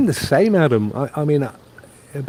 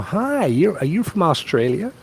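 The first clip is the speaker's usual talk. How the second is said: higher, mock-excited American stranger; imitating American strangers; 7 semitones higher than usual. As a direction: lightly mimic an eager American greeting. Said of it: "people are".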